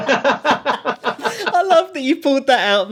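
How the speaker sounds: high-pitched laughter through the words; laughing. Real, not acted. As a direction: laugh hard while getting the words out, slowed down. Host laughs at user guide.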